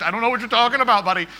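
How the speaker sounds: loud, rushed burst of disbelief; exasperated retort. Retold or imitated. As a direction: fire back in mock exasperation. Pilot's exasperated reply to passenger.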